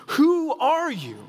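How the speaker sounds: drawn-out exasperated cry; exasperated demand. Acted. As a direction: throw the question up in frustration. Exasperated Pharisees.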